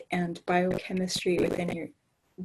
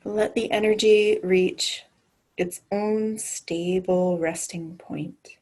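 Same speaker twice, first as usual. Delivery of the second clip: guided meditation. Acted. Leads a grounding meditation.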